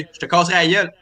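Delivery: Québécois accent, relaxed and rounded; Quebec French. Shown as a demonstration. Quebec host showing how Quebecers say it, in a Quebec French accent. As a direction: say it like a Quebecer would. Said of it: "a French from Quebec would say".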